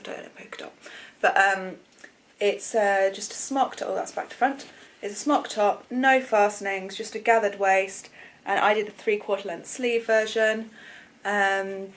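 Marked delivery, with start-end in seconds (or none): none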